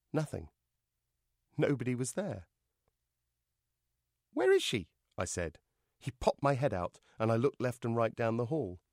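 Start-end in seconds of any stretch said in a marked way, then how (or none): 4.3-4.9 high-pitched puppet question; baffled dummy. Acted. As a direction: ask bewildered, high up.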